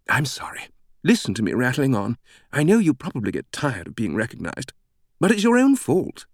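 apologetic, then playfully teasing British voice; chatty British woman. A narrator's reading. British greeter apologizing to Langdon for rattling on, playfully chatty. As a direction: rattle on brightly, leaping high on the tease.

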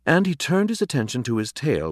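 usual speaking voice

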